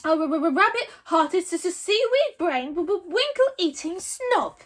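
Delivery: high, loud stammering dragon; stuttering dragon insult. A narrator's reading. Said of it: "said Toothless calmly".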